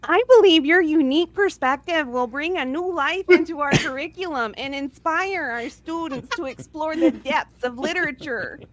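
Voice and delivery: over-the-top thrilled greeting; enthusiastic principal. A narrator's reading. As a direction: burst out with hammed-up delight. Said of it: "her voice rich with genuine enthusiasm".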